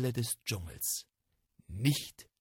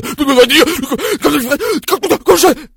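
much higher, very loud panicking tiger; panicked tiger; 19 dB louder, faster than usual. Acted. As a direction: gasp it out in terror. (panischer, lauter Tiger)

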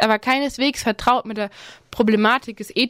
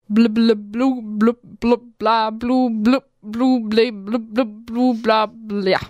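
nonsense babble; much slower than usual. Acted. Karl's invented language babble.

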